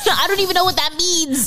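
high-pitched, laughing disbelief; exasperated bafflement. Real, not acted. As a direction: protest in a high, baffled squeal. Baffled by slang translation request.

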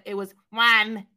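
"Juan" slowed and pushed; stressed "Juan". Shown as a demonstration. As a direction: draw out "Juan". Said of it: "it was not Juan".

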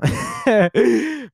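a high breathy laugh; quick amused laugh; short laugh. Real, not acted. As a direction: laugh at her own list. Laughs mid-list.

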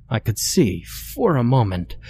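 usual speaking voice

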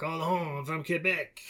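lightly hammed-up French Canadian accent; mock French Canadian accent. Actor given a Quebec backstory, mock French Canadian accent. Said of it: "like, a French Canadian backstory or whatever".

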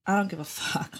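whispered mock bravado, unvoiced. A woman mocking men, whispered. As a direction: breathe it like a careless guy. Imitating men's attitude.

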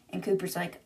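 ordinary delivery, nothing marked

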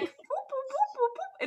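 high, slow boop boop noises; boop sounds. Shown as a demonstration. Showing what the placenta looks like with high boops. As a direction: squeak it out high, slow and bouncing. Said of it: "It's like".

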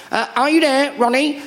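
high put-on calling voice; soap character calling. Soap character calling for Ronnie, high put-on call. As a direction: call out high for someone offstage.

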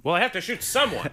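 high-pitched mock protest; mock exasperation. Voices the arguing gunman.

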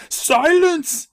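high, loud hammy scream; screaming judge. Acted. Judge cutting off Matt, hammy scream. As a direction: scream it to shut him up. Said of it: "the judge screams".

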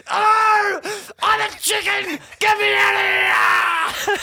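high, loud panicked shout; scared guest yelling the safe words; screaming at top of lungs. Retold or imitated. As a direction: scream to be let out. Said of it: "At the top of my lungs".

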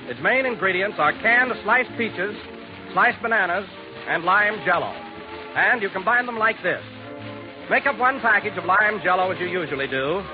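ordinary talk

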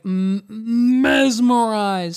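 slow, drawn-out awe; imitating an awestruck onlooker; mock mesmerized. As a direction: stretch the word out as a spellbound spectator. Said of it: "the guy at the end was like".

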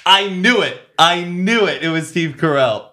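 high, loud, triumphant exclaim; excited I-knew-it. Real, not acted. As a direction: crow that you guessed it right. Excited guess confirmed.

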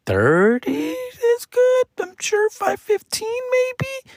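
friend's clueless guess in a high mock voice; mock unsure voice. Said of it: "He's like".

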